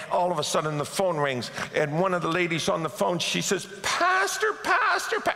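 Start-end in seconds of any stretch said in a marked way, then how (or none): 3.8-5.3 high-pitched mock-tearful wail; crying. Church lady phones her pastor, imitated crying. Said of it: "and she's crying".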